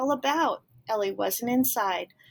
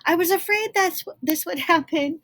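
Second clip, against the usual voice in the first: high, loud fretting cartoon; squeaky worried cartoon figure; 8 semitones higher, 5 dB louder than usual. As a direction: fret it out like a little cartoon. Cartoon character voiced, anxious.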